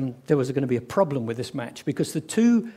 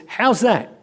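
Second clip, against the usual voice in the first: loud, raised appeal for the catch; cricket appeal; 5 semitones higher, 6 dB louder than usual. Acted. Bowler appealing to the umpire after a catch, calling out. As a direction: shout it to the umpire.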